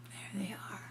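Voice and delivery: hushed whispered aside. Real, not acted. Parent whispering to child about the picture, hushed whisper. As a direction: breathe it softly. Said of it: "whispered aside".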